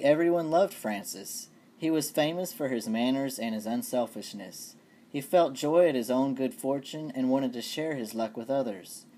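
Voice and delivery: ordinary talk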